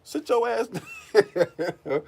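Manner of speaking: high, mocking dismissive voice, slowing into a laugh; high mock retort that breaks into a laugh; imitating Durant. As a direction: brush the coach off, cracking up. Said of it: "It's like".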